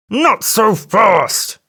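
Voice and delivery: slow, drawn-out angry cry; elephant cries out. A narrator's reading. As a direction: stretch every word as you give chase. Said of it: "cried the elephant".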